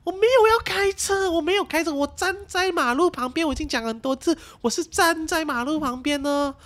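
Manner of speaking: high, loud, fed-up scolding; retold angry rant at the driver; exasperated rant. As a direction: vent at him like he never listened.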